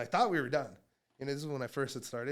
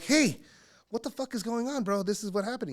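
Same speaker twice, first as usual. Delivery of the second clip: alarmed demand; 8 semitones higher, 4 dB louder than usual. Acted. Phoning friend in alarm.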